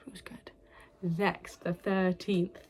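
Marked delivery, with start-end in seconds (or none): 0.1-0.5 whispered aside. Real, not acted. Whispered repeat of verdict.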